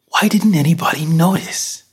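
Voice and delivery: high-pitched, incredulous question; baffled and rattled, pitched well up; distressed questioning. Acted. Shaken man asking himself why nobody noticed, distressed.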